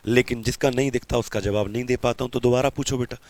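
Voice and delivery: normal talking voice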